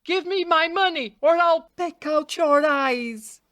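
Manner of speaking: furious little bird threatening its debtor; angry cry. A narrator's reading. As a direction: cry the threat out, spitting with rage. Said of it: "the sparrow cried to the raven".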